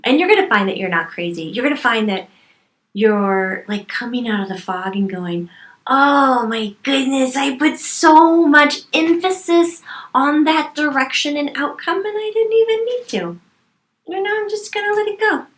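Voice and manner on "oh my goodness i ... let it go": high, emphatic self-talk of sudden realization; amazed realization. Acted. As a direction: exclaim it high, as if a fog just lifted. Acts out coming out of the fog.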